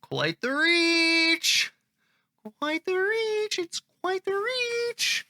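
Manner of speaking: very high, stretched-out vowels; high drawn-out mockery. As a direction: draw it way out on a high held note. Mock-reads a commenter's drawn-out phrase.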